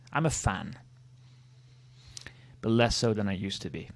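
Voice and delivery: usual speaking voice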